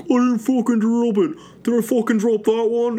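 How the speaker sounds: high, furious yelling; mock him freaking out; angry outburst. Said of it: "he's like".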